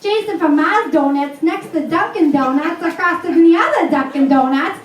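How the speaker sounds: hammed-up local car commercial pitchwoman with New England accent; Lois. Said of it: "she sounds just like, uh, Lois".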